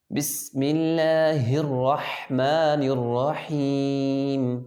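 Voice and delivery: slow recitation, full thick r in "الرحمن الرحیم"; heavy ra. Shown as a demonstration. Teacher shows thick ra, slowly reciting "الرحمن الرحیم" with heavy r. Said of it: "اس را کو موٹا کریں گے".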